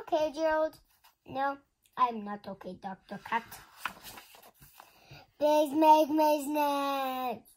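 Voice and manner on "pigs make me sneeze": slow lament. Acted. Gerald laments slowly.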